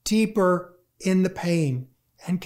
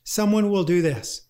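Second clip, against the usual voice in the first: ordinary delivery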